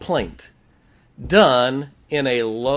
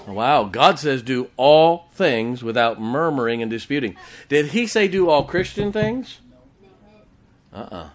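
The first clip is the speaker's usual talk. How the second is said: usual speaking voice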